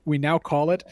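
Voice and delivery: normal talking voice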